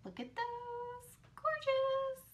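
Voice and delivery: breathy, high, hushed admiration; awed hush over gorgeous pearls; hushed awe. Real, not acted. Admiring pearls just opened.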